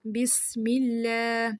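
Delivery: بسم with a light clear س, not ص; slow بسم اللہ, thin س; clear seen. Shown as a demonstration. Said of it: "یہ والی ساؤنڈ آئے". (بسم میں صاف سین)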